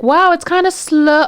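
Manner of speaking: high, slow sing-out of wonder; imitating naive coworker. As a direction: muse obliviously, drawn out.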